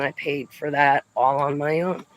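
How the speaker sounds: ordinary talk, nothing marked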